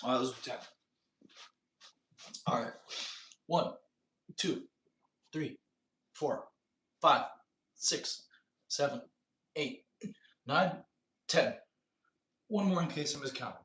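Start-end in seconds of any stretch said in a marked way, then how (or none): none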